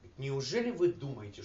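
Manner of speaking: ordinary talk, nothing marked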